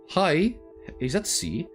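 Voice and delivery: normal talking voice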